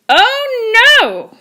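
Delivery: high squeaky pig cry; little pigs answering. A narrator's reading. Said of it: "answered the little pigs".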